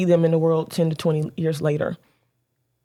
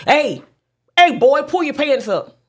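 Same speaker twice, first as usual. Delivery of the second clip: sharp, raised call across the street; loud rude call; 9 semitones higher, 6 dB louder than usual. Retold or imitated. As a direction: bark the order at the boy. Rude call to a young man.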